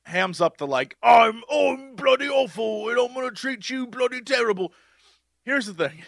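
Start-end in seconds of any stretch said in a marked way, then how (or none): 1.0-4.7 hammed-up Ramsay. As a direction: shout it like a hammy TV chef. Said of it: "Gordon Ramsay on Hell's Kitchen, hams up".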